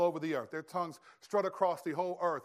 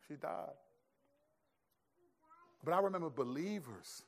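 normal talking voice

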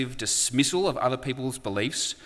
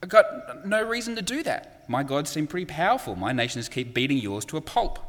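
scornful nations; 7 semitones higher than usual. Retold or imitated. The nations mocking Israel's God, scornful disbelief. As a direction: sneer at the puny God. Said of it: "They turn around and go".

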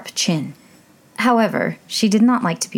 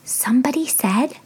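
whispering; 7 semitones higher than usual. A narrator's reading. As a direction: whisper it secretly. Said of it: "Alice whispered".

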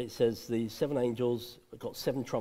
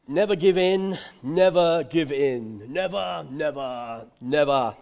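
louder, rousing wartime oratory; a hint of Churchill; 7 dB louder, slower than usual. Preacher quoting Churchill with a rousing, louder voice. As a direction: declaim it like a wartime leader. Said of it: "That's Winston Churchill".

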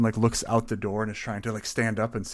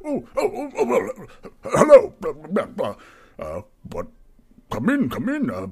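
nervous barkeep; 7 semitones higher, 8 dB louder than usual. Acted. GM voices nervous barkeep Barry.

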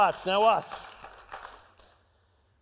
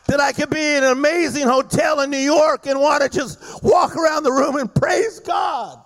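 full-force preaching; 4 semitones higher than usual. Acted. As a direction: build the congregation up, full voice.

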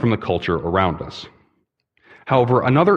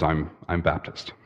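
softer, stammering reply; timid young man; 5 semitones lower, 8 dB quieter than usual. Acted. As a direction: mumble it hesitantly. Joke: timid young man answers.

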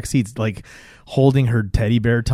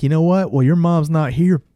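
mock nasty dad, pitched up; nasty retort; 5 semitones higher than usual. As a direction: throw it back meanly, imitating an angry father. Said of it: "he's all nasty to her".

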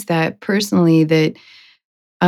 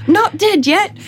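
high, squeaky protesting voice; not-dead man; 12 semitones higher than usual. Retold or imitated. Body on shoulder objecting to the cart, high protest. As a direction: protest from over his shoulder, pitched way up. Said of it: "the person over his shoulder is like".

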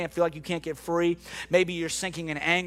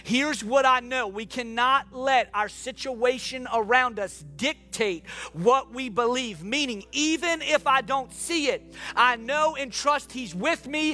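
higher, building preacher's voice; impassioned preaching; 8 semitones higher than usual. Real, not acted. Preacher urging people to keep asking God, impassioned. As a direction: preach it with mounting conviction.

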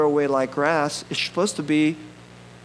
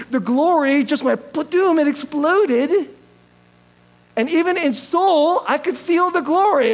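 excited; 8 semitones higher, 3 dB louder than usual. Retold or imitated. Curry's big finals win.

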